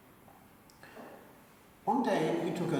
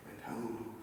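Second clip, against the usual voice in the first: whispered, much softer; hushed whisper; 8 semitones lower, 16 dB quieter than usual. A narrator's reading. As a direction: breathe it like a secret prayer. Narrating a man praying in despair.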